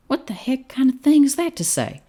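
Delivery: high, loud squawk of protest; indignant outburst. Acted. Cowboy to doctor over a blunt prognosis, indignant and high. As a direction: protest in a high, offended yelp.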